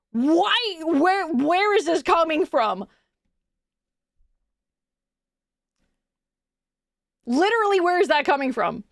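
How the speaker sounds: high, incredulous mock rant; exasperated disbelief. Speaker confronting classmates over their accent, exasperated. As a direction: pitch it up and demand an explanation.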